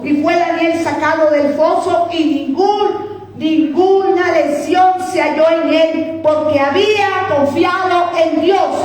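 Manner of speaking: high-pitched, exultant preaching; fervent preaching. Real, not acted. Preacher tells the congregation of Daniel's rescue, exultant preaching. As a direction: build steadily into praise.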